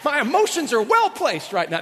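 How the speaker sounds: high, loud mock indignation; mock outraged wife. Wife told to chill, hammed-up loud high outrage. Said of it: "women that were going".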